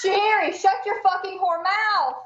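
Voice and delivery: yelling at the cat. Real, not acted. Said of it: "That just went over the air".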